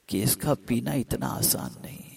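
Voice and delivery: whispered, soft; hushed aside. Acted. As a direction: drop to a whisper to make it weigh. Stressing how hard it is. (سرگوشی میں)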